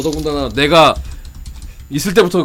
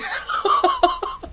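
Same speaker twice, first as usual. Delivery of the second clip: high laugh; 7 dB quieter than usual. Real, not acted. Laugh after a joke.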